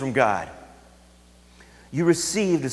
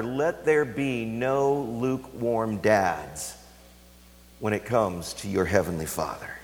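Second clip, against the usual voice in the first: slow appeal; 7 semitones lower, 5 dB quieter, slower than usual. Real, not acted. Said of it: "slow earnest appeal".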